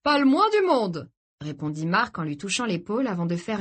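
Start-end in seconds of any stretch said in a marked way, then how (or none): 0.0-1.1 cheerful host. Acted. Host answering the guest.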